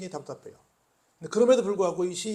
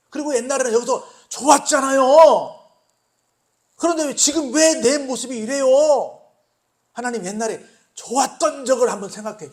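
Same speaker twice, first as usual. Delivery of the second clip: loud, higher lament, slowing at the end; pleading outcry; 5 semitones higher, 10 dB louder than usual. Acted. Believer crying to God about better days, loud plea. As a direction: cry out to God in complaint.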